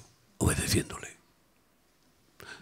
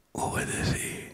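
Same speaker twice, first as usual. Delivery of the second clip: "Obedecí" whispered softly; 10 dB quieter, slower than usual. Real, not acted. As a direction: breathe the word "Obedecí" as a hushed whisper. ("Obedecí" susurrado en voz baja)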